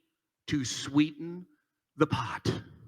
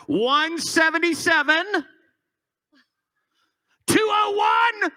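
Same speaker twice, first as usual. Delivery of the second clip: auctioneer calling; 7 semitones higher than usual. Acted. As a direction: shout the numbers like a live auction. Calling out bid numbers.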